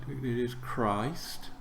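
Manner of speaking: ordinary talk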